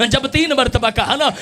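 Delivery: ordinary delivery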